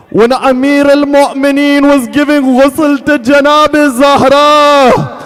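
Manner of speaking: loud, high, tearful mourning chant; full-force grieving wail from the pulpit; wailing lament sermon. Acted. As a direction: cry the story out in anguish, stretching the last words. Wailed lament over Fatima's death.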